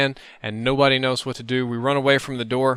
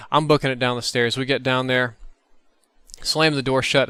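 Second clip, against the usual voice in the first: ordinary delivery, nothing marked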